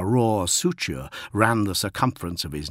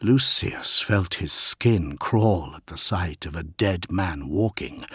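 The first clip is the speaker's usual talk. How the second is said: ordinary delivery, nothing marked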